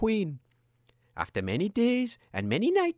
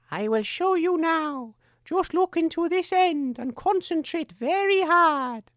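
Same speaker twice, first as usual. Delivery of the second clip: higher, slow, coaxing; scheming princess; 9 semitones higher, slower than usual. Acted. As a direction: lure her in slowly. Sarah tricks the queen.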